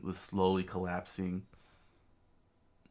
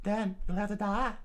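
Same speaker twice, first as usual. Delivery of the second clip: high cold threat; 13 semitones higher than usual. Acted. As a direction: say it coldly, much higher. Robin threatens.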